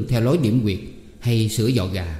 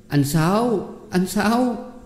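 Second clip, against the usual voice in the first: calling out; 9 semitones higher, much slower than usual. Acted. Girl calling out for help.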